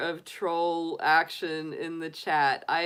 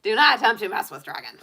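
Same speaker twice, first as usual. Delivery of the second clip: fast, louder cartoon dragon warning, laughing through it; playful dragon threat, rushed; 4 semitones higher, 8 dB louder, faster than usual. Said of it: "I have a total dragon voice".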